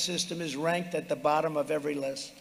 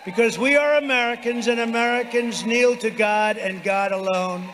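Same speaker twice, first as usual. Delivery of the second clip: shouted rally cry, pitched high; roaring rallying speech; 4 semitones higher, 5 dB louder than usual. Real, not acted. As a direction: bellow it to the arena.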